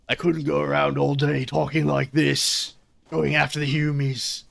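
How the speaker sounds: slow, rough attempt at an orc voice; 40K orc. Shown as a demonstration. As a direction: put on a drawn-out orc brute voice. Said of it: "do a forty K orc, I just can't do the voice".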